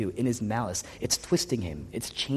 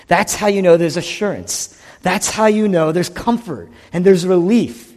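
each line louder and higher, full preaching force; building preaching climax; 5 semitones higher, 7 dB louder than usual. Real, not acted. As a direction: drive it up to a triumphant peak.

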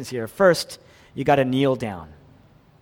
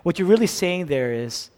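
ordinary talk, nothing marked

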